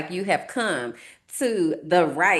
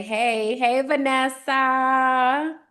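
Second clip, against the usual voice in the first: chat greeting; 7 semitones higher, much slower than usual. Pretend. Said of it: "come on into the live chat and say".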